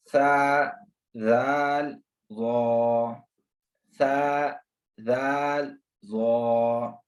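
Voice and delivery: सा and जाल light, ज़ो full and heavy. Shown as a demonstration. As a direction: repeat the drill with ज़ो thick. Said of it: "ज़ो जो है वो पुर पढ़ना है".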